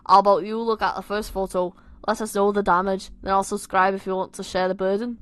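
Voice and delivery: loud, amateur Scouse; Liverpool accent. Acted. Tom asking Simon to look, Liverpool accent. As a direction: lay on a put-on Liverpool accent. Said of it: "time for my Liverpool accent".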